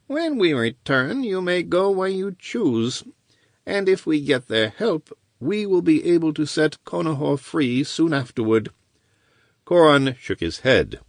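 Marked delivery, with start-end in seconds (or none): 0.1-8.7 higher-pitched wizard voice, smooth and sure; lightly voiced scheming wizard; wizard's bargain. Wizard promising the corsair his freedom, scheming.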